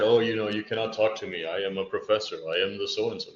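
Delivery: haughty professor, pitched far down low; aloof professor. Acted. Professor turning students away from the ivory tower, self-important. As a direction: put on a deep, self-important academic voice. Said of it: "We're not on this ivory tower".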